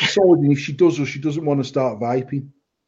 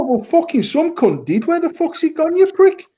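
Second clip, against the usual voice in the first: mock outrage; 12 semitones higher than usual. Shop owner mock yelling at a customer over empty bottle, rising outrage.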